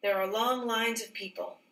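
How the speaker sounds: ordinary delivery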